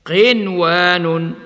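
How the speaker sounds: قنوان with the nun clearly sounded; clear nun before waw. Shown as a demonstration. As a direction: isolate قنوان, nun fully shown. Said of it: "ومثلها". (إظهار النون في قنوان)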